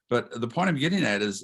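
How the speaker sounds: usual speaking voice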